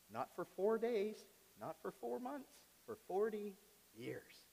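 slow and hushed, "four days" and "four months" pitched high; dramatic emphasis. Real, not acted. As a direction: draw out "forty years" softly, with a pause before "years". Dramatic build to length of exile.

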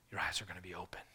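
breathy secret whisper. Retold or imitated. Devil whispering the promise to Eve, hushed. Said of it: "the devil, Satan, saying".

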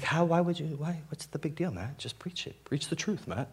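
quiet, high-pitched mock questioning; challenging listener. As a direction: play the skeptical listener in a small, soft voice. Voices congregation challenging him.

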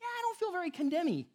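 high, fast flippant voice; playful imitation of an unconvinced listener; mock skeptic. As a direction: brush it off like a doubtful listener. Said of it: "if you're like".

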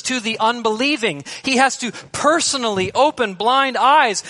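high, loud, driving sermon delivery; impassioned preaching. Real, not acted. Preacher urging the church to highlight the gospel, impassioned. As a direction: preach it urgently, landing hard on key words.

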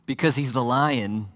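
normal talking voice

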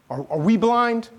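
high, rising, hesitant question. Acted. Pharisees asking Jesus about his judgment, questioning. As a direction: ask it high with a stumbled start. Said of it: "I don't think they're asking in, like, a happy kind of way".

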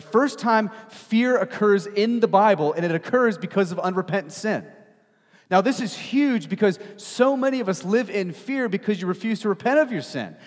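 loud, high-pitched sermon delivery; impassioned preaching. Acted. Preacher telling congregation God comes after them, impassioned and loud. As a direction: preach it out with force, punching key words.

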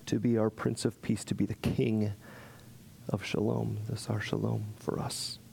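hushed prayer to close the service; closing prayer. Real, not acted. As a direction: pray gently over the congregation. Pastor leads closing prayer.

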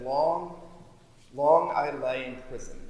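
hymn line recited with feeling; reciting hymn lyrics. Acted. Preacher quoting Wesley's hymn to listeners, recited with feeling. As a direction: dwell on the long. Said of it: "And he said".

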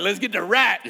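rally the troops for the hunt; fired-up competitive. Retold or imitated. A dad rallies his son to catch the rat, loud and eager. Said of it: "my competitive person coming out".

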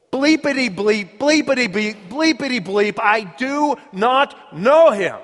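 high, emphatic censored cursing; mock swearing. Peter cursing and swearing he doesn't know Jesus, hammed-up. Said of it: "invoked a curse on himself and swore".

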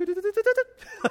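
high, rapid video-game power-up sound; power-up noise. Acted. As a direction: imitate a loud, high power-up jingle. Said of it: "it's like a power up".

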